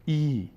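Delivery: "ای" held as a clear long ee; long "ee" vowel. Shown as a demonstration. Teacher demonstrating a vowel for learners, long ee. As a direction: sound out "ای" as a long ee.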